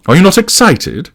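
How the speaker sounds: high-pitched indignant question; rounding on him. A narrator's reading. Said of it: "rounding on him".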